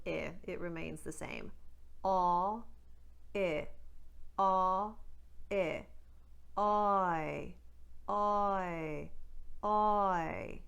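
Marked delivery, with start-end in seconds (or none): 2.0-10.6 Newfoundland "I" starting on "aw"; Newfoundland accent, aw-I glide. Shown as a demonstration. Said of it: "they tighten up the first part".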